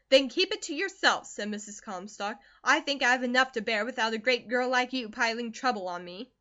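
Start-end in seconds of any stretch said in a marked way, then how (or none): 0.1-1.3 loud, higher snap back; sharp retort. Acted. Mother cutting off her daughter, a loud sharp retort. 2.6-6.3 a loud, grumbling hard mother; stern mother. Acted. Mother scolds her grown daughter, loud and stern. Narrator voices Mrs Comstock.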